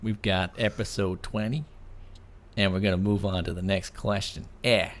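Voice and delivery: hammed-up Canadian accent with eh; goofy Canadian host voice, laughing. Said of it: "He wants me to do a Canadian accent".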